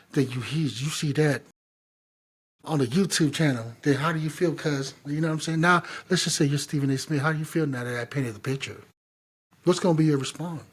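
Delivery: ordinary delivery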